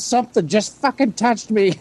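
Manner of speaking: high-pitched mock alarm; frightened mom. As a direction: imitate a spooked mother, voice jumping up. Frightened mom after screaming, retold.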